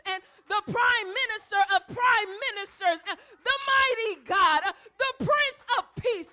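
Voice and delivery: loud rising preacher's cry, building to a shout; full-force preaching. Acted. Preacher shouting praise to the congregation, full force.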